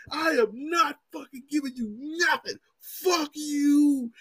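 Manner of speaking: high, drawn-out wail of outrage; so mad. Retold or imitated. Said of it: "she was so mad".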